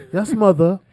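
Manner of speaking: put-on high, meek kid voice; mock meek child. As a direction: answer like an obedient little kid. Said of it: "You want like".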